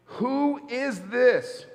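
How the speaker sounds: high, very slow, drawn-out who is this; awed, frightened question. Acted. Disciples in the boat after the storm, slow awed fright. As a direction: stretch each word out in stunned fear. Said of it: "they were all terrified".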